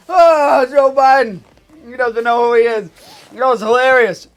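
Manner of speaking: host faking huge laughs at Obama's joke; hammed-up laughter. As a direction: overact laughing to flatter the guest.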